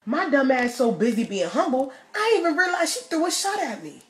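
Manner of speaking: ordinary talk, nothing marked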